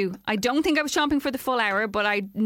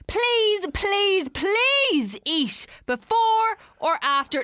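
high, slow pleading please; begging; 11 semitones higher, 3 dB louder, much slower than usual. Retold or imitated. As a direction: beg them over and over. Reading out begging complaint.

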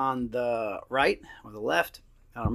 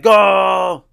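full-throated goal yell, much louder and higher; shouted goal; 7 semitones higher, 13 dB louder than usual. Retold or imitated. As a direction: bellow it like a fan off the couch. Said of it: "I yelled, I screamed".

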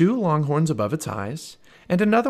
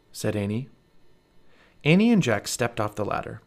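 ordinary delivery

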